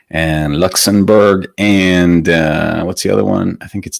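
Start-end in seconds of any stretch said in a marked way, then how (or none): none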